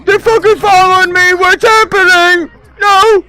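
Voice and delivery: loud, high panicked yelling. Real, not acted. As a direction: yell in real panic. Being chased, yelling for help.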